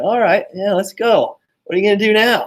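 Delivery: high, loud cocky challenge; a kid daring his mom after the spoon broke; defiant taunt. Retold or imitated. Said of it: "I said".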